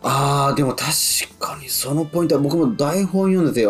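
ordinary delivery

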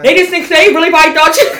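words breaking into high, loud laughter; laughing. Real, not acted. Cracking up while talking about foreigners, laughing. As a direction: talk through a fit of laughing.

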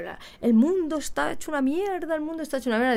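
lightly voiced fed-up sister; sister's gloomy complaint. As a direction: mimic her moaning that all is ruined. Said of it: "y siempre me dice".

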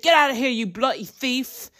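a hint of an angry London bloke shooing someone; London Brit. Restaurant owner shouting at a homeless man, light mock British. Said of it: "And he's like".

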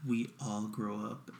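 low, soft, slow doom; mock solemn. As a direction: intone it slowly like a grim moral. Mock-solemn moral of the film.

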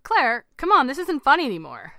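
loud, high, worried urging; growing concern. A narrator's reading. Husband telling his wife the joke is over, concerned. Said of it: "Terry expressed his growing concerns".

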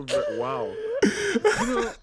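very high squeal of shock. Real, not acted. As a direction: shriek in laughing disbelief. Shocked by graphic joke.

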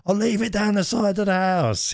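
high, mimicked old man calling back; shouting old boy. Delivery man confirming where the parcel goes, mock old-boy shout. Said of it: "He's shouting like, like he's an old boy".

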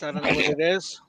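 ordinary talk

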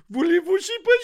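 high, loud, over-the-top Parisian French; mock Parisian French. How French Canadians hear people from France, as a shrill Parisian. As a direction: squeal it like a prissy Parisian. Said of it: "they hear this".

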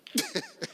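high-pitched quick laugh; amused high laugh; high giggle. Real, not acted. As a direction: squeak out a laugh. Laughs at his roach joke.

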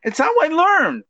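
very high-pitched, playful whine; mock childish protest. Guy defending his touching habit to a teasing friend, mock childish squeal. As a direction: squeal it like a kid defending himself. Repeats it after being called a child.

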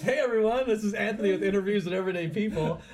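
high, loud host greeting; show intro. Real, not acted.

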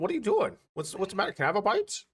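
dog voice. Retold or imitated. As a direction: voice the dog asking for food. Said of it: "My dog's looking up at me like".

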